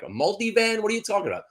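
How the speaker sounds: mock disbelief, pitched well up; retold bafflement. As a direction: act out your own puzzled reply to your aunt. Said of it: "I'm like".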